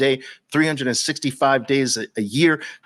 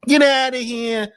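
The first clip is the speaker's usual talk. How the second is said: Brooklyn get outta here; 9 semitones higher than usual. Shown as a demonstration. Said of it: "That's how we used to say it in Brooklyn".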